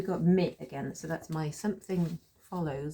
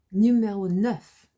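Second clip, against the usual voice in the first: slow, higher, much louder French announcement; announcing next item; 4 semitones higher, 11 dB louder, slower than usual. Shown as a demonstration. Teacher moving learners to sentence nine, announcing it. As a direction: call out the French number boldly.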